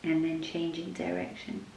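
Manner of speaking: soft, unhurried yoga nidra voice with long pauses; guided meditation. Acted. Leads a yoga nidra practice.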